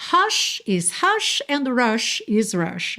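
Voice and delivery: slow, careful English hush and rush; English pronunciation. Shown as a demonstration. Teacher contrasting English hush and rush, slowly. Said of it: "se eu estiver falando inglês".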